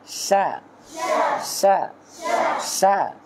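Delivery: সা said "sha" through a slight smile; smiling sheen. Shown as a demonstration. Teacher shows pupils how it should be, smiling "sha" for সা. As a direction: spread the lips in a smile for the sh of সা. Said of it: "এরকম হবে".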